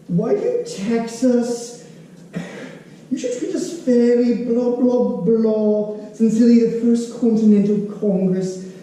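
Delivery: mocking letter reading. King reads letter mockingly.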